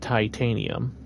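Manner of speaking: titanium with a long "tie-TAY-nee-um"; deliberate titanium. Shown as a demonstration. Gamer carefully shows his odd word titanium, said "tie-TAY-nee-um". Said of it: "saying this this way".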